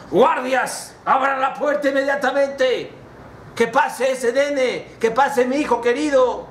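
high, excited calling out; acted overjoyed father; urgent royal command. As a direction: summon the guards at once, voice high. Father urgently orders gate opened.

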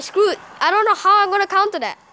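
rising, exasperated complaint. Real, not acted. Player despairing over an unstoppable push, exasperated. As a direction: protest helplessly at the game. Facing a push he cannot stop.

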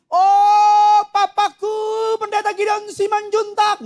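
high, drawn-out ceremonious cry; mock formal yell. Child calling father downstairs by full title, mock formal yell. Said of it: "Dia nggak pernah teriak begini".

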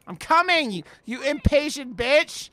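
exasperated yell. Real, not acted. Yelling at impatient teammate.

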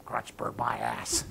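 low, slow, soft scoff; dismissive. Acted. Husband scoffing at his birthday present, dismissive. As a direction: drop it low and unimpressed.

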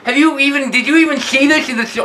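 normal talking voice

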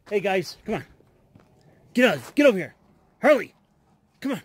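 dog owner calling his dogs over loudly. Real, not acted. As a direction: shout across to call the dogs back.

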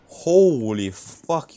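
stunned. Real, not acted. Shocked at enemy tankiness.

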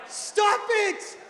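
hammed-up furious shout; exaggerated outburst at the mentor; mad. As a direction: scream it high and loud in mock rage. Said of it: "You know, I'm so mad".

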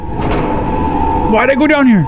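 dreading. Real, not acted. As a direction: dread creeping in. Descending into gore pit.

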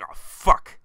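sudden loud, unvoiced curse; inner outburst. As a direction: let the hidden thrill burst out in one curse. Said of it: "but deep down inside, he's like".

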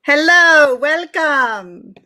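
bright, loud, high welcome; excited greeting. Real, not acted. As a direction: greet her with real excitement. Host greets guest on air.

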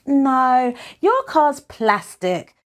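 lightly acted dismissal; dismissive refusal. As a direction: turn the offer down with a touch of disdain. Boy rejects friend's offered swap.

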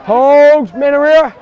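loud calls across the field; shouting calls. Real, not acted. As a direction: call it out loud. Referee shouting calls in play.

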